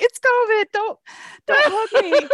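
high, loud, laughing no; laughing refusal. Retold or imitated. Andrea to grateful man, laughing refusal. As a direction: fend off the hug laughing.

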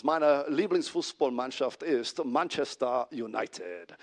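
slow, put-on German accent. Exchange student speaking German to his host family, German accent. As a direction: say it slowly as a German would. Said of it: "say Manchester United in a German accent".

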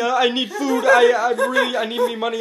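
high, loud stammered plea; mock desperate begging. Retelling a skinny man begging, hammed-up desperate plea. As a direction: imitate frantic pleading through amusement. Beggar's pleading, retold.

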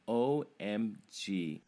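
drawn-out, lightly mocking O M G; imitating his son. Dad slowly quoting his son Chase's slang. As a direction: spell it out slowly like a teen would. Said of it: "As my son Chase would say".

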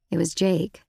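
ordinary delivery

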